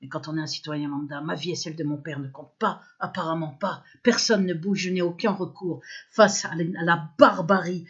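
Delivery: normal talking voice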